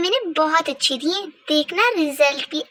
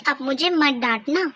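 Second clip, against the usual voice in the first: normal talking voice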